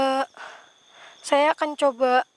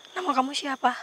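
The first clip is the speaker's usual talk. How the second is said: ordinary delivery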